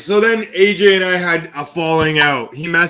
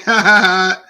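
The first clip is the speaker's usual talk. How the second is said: sarcastic spelled-out ha ha ha, a bit higher and louder; mock laugh; 4 semitones higher, 5 dB louder than usual. Answering the rat threat with a sarcastic laugh.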